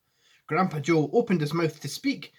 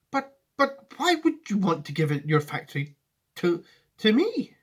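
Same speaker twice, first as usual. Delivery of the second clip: put-on raised, halting boy's voice; stammering Charlie; 6 semitones higher, 4 dB louder than usual. Charlie asking Wonka why, halting and raised. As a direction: stumble over it in wonder.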